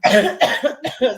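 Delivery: a sudden loud dry cough; sharp cough. Real, not acted. Guest coughing during live interview, sharp loud cough. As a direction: cough hard, caught off guard. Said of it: "Excuse me".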